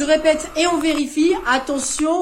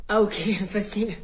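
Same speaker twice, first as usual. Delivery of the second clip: ordinary talk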